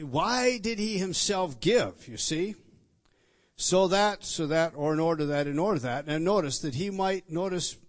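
loud, rising sermon fervour; preaching at full force. Real, not acted. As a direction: drive the point home to the congregation.

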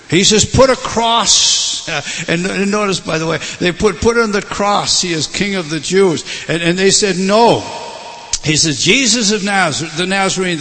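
loud, rising pulpit delivery; preacher voicing Pilate and the crowd at full force; full-force preaching. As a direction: drive the climax home. Preaching the crucifixion climax.

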